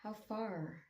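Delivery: slow, soft meditation voice with long pauses; guided meditation. Real, not acted. Meditation teacher guiding listeners to relax, in a slow, soft voice. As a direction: ease listeners gently into stillness, softening as you go.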